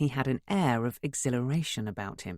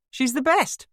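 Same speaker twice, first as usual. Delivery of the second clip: announcing; 12 semitones higher, 6 dB louder than usual. A narrator's reading. Announcing to guests.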